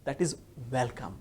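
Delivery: "welcome" slow and soft, W with rounded lips; correct welcome. Shown as a demonstration. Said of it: "Where my lips are round".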